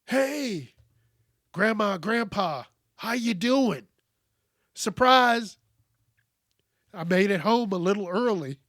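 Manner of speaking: bright reenacted homecoming greeting; cheery surprise greeting. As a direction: pop in and surprise them. Acting out surprise visit to grandparents.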